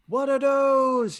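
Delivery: drawn-out, high, loud exclamation; mock-appalled shout. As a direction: cry out slowly in comic disbelief. Retells first reaction to flat rides.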